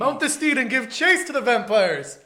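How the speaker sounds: loud high knightly war cry; rally the troops like a cavalier; mock heroic cry. Mocking partner's phrase.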